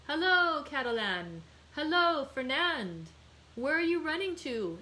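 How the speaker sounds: loud hail, pitched high; calling out. A narrator's reading. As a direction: shout across to him. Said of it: "exclaimed a voice".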